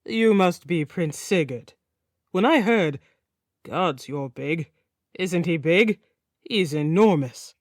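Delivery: a hint of a chatty Nordic king, amazed; lightly voiced King Gitzer. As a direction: gush over the big visitor. King Gitzer greets Sigurd.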